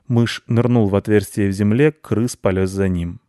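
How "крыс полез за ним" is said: usual speaking voice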